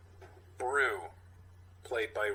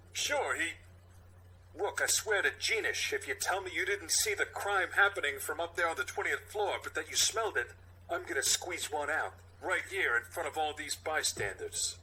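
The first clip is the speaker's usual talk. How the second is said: higher, fed-up threat; higher exasperated threat; exasperated cop; 5 semitones higher than usual. Acted. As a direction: snap at your partner.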